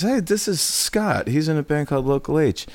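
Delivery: high, chirpy retold introduction; woman making introductions. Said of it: "and she goes".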